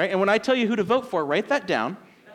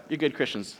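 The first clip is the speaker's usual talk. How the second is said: ordinary talk, nothing marked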